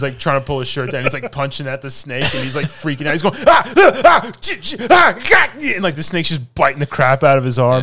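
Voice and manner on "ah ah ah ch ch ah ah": high, loud, laughing mock screams of pain; panicked yelps. Brad Pitt's character bitten by the snake, panicked yelping. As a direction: yelp like a snake is biting your arm. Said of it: "And he's freaking out".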